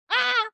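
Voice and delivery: a short high delighted squeal; excited squeal. Real, not acted. Reacting to the lovers' tender kiss, high excited squeal. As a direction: let out a sudden fangirl shriek of joy. Gushing over the couple's kiss.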